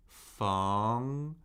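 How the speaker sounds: "feng" rising steadily; second tone. Shown as a demonstration. Teacher cycling four tones on a syllable, "feng" rising. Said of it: "Let's do the four tones".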